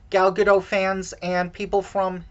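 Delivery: ordinary delivery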